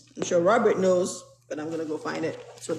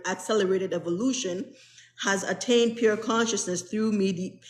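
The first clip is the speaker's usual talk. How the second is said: normal talking voice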